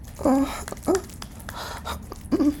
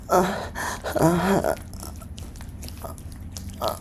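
ordinary delivery

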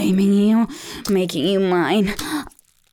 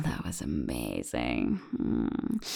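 much lower and much softer, barely a whisper; spent whisper; 11 dB quieter than usual. Acted. Lover to partner after sex, low exhausted whisper. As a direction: let it drop away into a whisper.

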